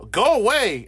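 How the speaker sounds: stern. Acted. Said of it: "Bruce was very stern".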